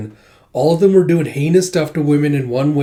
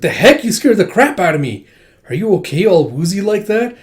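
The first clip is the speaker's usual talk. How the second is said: startled complaint; 4 semitones higher, 5 dB louder than usual. Acted. Startled character reacts to squeal.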